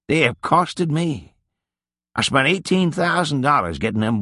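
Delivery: lightly voiced old Nig, rueful and rambling; a hint of a tired old drinker looking back; old man's storytelling. Old Nig tells his life story.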